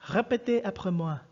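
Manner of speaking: "Répétez après moi" with French vowels and a throaty R; teacher gives the French classroom command; French phrase. Shown as a demonstration. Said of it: "In French, we say".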